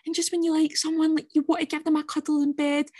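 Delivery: hammed-up lovelorn confession; mock heart-pouring. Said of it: "pouring her wee heart out".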